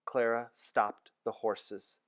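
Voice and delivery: ordinary delivery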